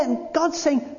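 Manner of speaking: ordinary talk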